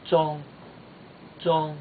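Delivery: "zhong" held high and level; high first tone. Shown as a demonstration. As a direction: keep "zhong" up high without falling. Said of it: "first tone is a high tone".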